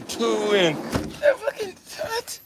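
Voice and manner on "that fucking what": incredulous squeak. Real, not acted. Incredulous squeak mid-rant.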